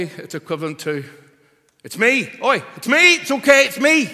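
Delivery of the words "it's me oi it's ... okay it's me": much higher and louder, calling out; emphatic reassurance. Retold or imitated. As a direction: shout it over the storm to calm them. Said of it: "It's real emphasis".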